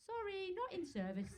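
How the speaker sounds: high, piping mock apology; apologetic bus. English bus announcing itself, mock apologetic. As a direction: imitate an over-polite bus sign. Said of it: "even your buses say".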